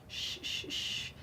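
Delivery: whispered shh, barely audible; soft shushing. Pretend. Babysitter hushing softly near the sleeping baby. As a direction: hush them without a sound.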